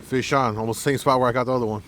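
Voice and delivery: loud fast call of a hooked fish; excited shout. Real, not acted. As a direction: shout it out, quick and thrilled. Hooks a fish, calls out.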